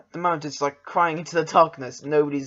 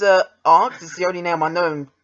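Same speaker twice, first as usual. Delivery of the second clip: ordinary delivery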